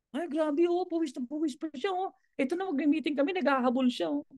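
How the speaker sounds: high, scandalised aside; mock a client whispering about the sweaty guy; gossiping client voice. Client complaining about a sweaty presenter, high gossipy tone.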